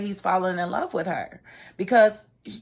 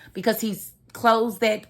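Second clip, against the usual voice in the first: normal talking voice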